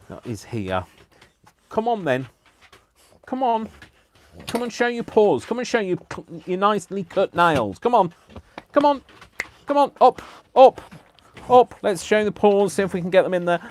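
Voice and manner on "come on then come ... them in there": much higher, louder sing-song coaxing; coaxing the dog. Real, not acted. Coaxing the dog to show paws.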